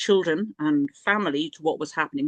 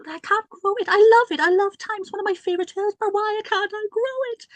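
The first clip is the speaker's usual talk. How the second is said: exasperated plea; 14 semitones higher than usual. Retold or imitated. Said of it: "I actually said to, you know, to Caridwen".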